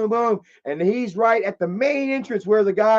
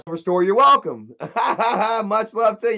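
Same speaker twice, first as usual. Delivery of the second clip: laughing; 3 semitones higher than usual. Real, not acted. Thanking viewer, laughing.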